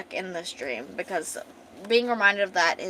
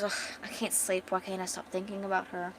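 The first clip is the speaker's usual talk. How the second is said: thinking to himself; 10 dB quieter than usual. Retold or imitated. Elf lying awake thinking about her, quiet musing. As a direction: voice his restless thoughts quietly. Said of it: "lying there with the thoughts to himself".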